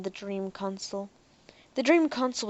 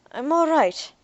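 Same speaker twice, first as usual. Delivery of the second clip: hoarse; 7 semitones higher, 5 dB louder, slower than usual. Acted. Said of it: "Derek said hoarsely".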